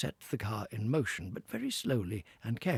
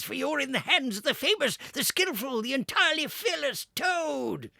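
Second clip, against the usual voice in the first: boastful Toad; 10 dB louder than usual. Acted. Toad boasting at full speed.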